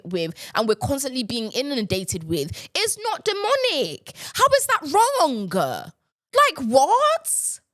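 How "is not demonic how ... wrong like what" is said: disbelieving what at the end; indignant outburst. Real, not acted. Rant peaks.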